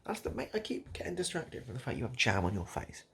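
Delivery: normal talking voice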